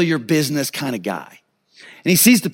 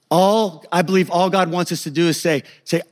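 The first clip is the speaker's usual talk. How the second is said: ordinary delivery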